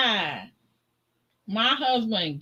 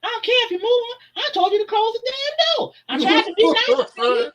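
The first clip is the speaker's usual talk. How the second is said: high, fast, heated scolding; angry yell; 14 semitones higher, faster than usual. Retold or imitated. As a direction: tell him off hard, fast. Husband yelling at the man.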